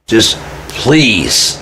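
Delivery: exasperated plea, stretched-out please; begging. Retold or imitated. Adult child begging mom to hand over the phone, pleading. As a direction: beg on one long falling word. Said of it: "begging for a while".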